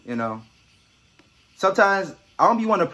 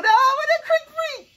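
much higher, slow squeal; squeaky falsetto; much slower than usual. Pretend. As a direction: squeal it up high like a cartoon. Hyping up a creepy creature.

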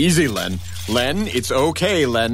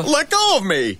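much higher, louder plea; panicked; 13 semitones higher, 4 dB louder than usual. Acted. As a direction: struggle free in panic. Friend grabbed.

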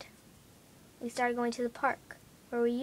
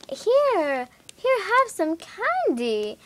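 mock lady; 8 semitones higher, 5 dB louder, much slower than usual. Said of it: "A lady was like".

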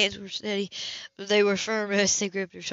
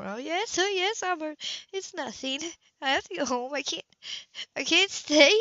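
tearful; 6 semitones higher than usual. Acted. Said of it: "She sobbed out breath".

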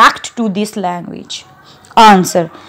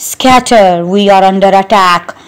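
ordinary talk

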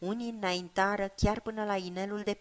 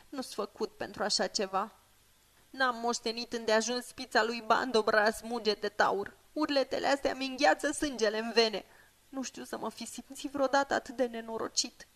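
higher, lighter hobbit voice, uneasy; a scared youngster admitting his fear; frightened young hobbit; 4 semitones higher than usual. Acted. Pippin confides his fear to Sam in a higher, uneasy hobbit voice.

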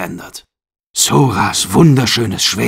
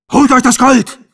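rallying cry; 12 semitones higher, 3 dB louder than usual. Acted. Captain urges crew to loot.

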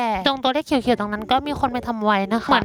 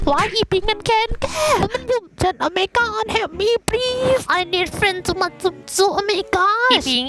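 mock panic; 7 semitones higher, much slower than usual. Attacked by player, crying help.

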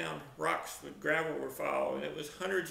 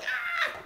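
very high mock shriek; high storyteller's scream; re-enacted scream. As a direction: yelp as if hit, acting it out. I'm screaming.

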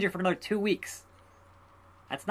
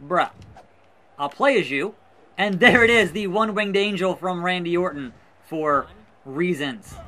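usual speaking voice

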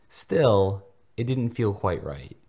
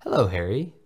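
chorused greeting; 4 semitones higher, 7 dB louder than usual. A narrator's reading. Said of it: "chorused in unison".

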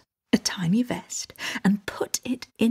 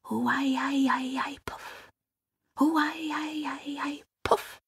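eerie wavering whisper ending in a puff; whispered magic spell; 5 semitones higher, 3 dB quieter, much slower than usual. A narrator's reading. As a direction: whisper an incantation, then puff it away. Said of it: "he would whisper".

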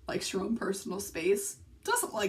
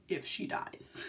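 hushed dread; 3 semitones lower, 7 dB quieter, slower than usual. Real, not acted. Dreading her dog's death.

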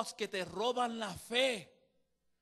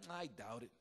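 dropped low and much softer, dismissive; mock doubter; 10 semitones lower, 9 dB quieter than usual. A doubter answers someone's encouragement, low and dismissive. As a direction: mutter the doubt back, deflated.

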